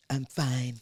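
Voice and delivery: low, soft, curt imitation of a sulking friend; sulky mimic. Imitating her sulking best friend insisting she's fine, low and curt. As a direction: mutter it like you're clearly not fine.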